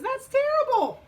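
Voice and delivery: very high, loud, drawn-out cry; acted shock, pitch leaping up; horrified frog. Frog reacts to Wolf's diet, horrified.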